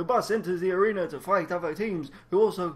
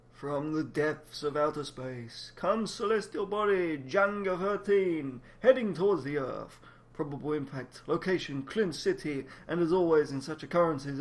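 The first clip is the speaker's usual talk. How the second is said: put-on old sci-fi announcer; 4 semitones lower, 5 dB quieter than usual. A narrator's reading. Deep trailer narration.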